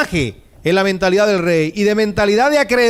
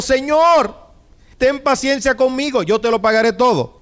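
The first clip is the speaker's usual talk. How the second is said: desperate pleading; 6 semitones higher than usual. Acted. Servant on his knees begging the king, dramatic pleading. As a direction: beg the master as if your life depends on it. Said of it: "Le suplicaba diciendo".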